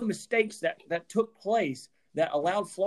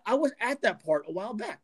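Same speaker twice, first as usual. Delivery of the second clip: usual speaking voice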